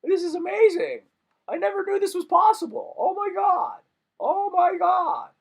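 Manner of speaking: high, loud, gushing amazement; amazed fan. Retold or imitated. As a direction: squeal it out like a starstruck fan. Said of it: "and then I'm just like".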